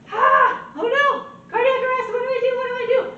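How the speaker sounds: high, loud hammed-up panic; mock panic. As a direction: pretend to freak out, squealing. Mock panic over cardiac arrest.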